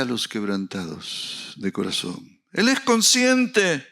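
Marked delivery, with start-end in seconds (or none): 2.5-3.9 high, loud, slow preaching cry; fiery preacher voice, drawn out; preaching at full force. Acted. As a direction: proclaim it to the whole church. Preaching climax.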